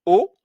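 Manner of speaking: « O » fermé, lèvres arrondies; son o fermé. Shown as a demonstration. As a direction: arrondissez la bouche pour « O ». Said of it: "se prononce". (le professeur montre le son « o »)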